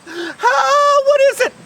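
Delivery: high, loud cry of fright; terrified scream. Acted. Disciple in the boat seeing a ghost, terrified scream. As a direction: scream out in alarm, high and stretched. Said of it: "We wanted to scream out".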